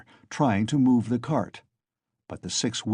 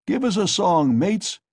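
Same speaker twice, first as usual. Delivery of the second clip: high, loud rowdy call; shouted; 8 semitones higher, 4 dB louder than usual. A narrator's reading. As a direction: call out to the cart. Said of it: "shouted someone in the cart".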